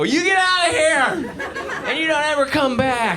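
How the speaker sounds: hammed-up triumphant yell; high shouted send-off. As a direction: bellow it out like the big finale. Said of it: "And I go".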